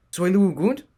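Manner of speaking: high, loud questioning creature voice; a small gar asks a suspicious question. A narrator's reading. Small gar questioning strangers at the door, high and loud. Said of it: "spoke in a questioning tone".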